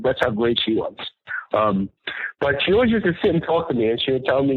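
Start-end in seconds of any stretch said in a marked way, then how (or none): none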